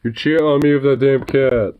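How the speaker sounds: high, loud put-on pet complaint; mock jealous-lover outburst; jealous dog voice. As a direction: voice a wronged dog, mock-outraged.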